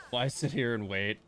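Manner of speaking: ordinary delivery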